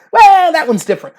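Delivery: mock excuse. Partisans excusing their own Democrat, mock defensive.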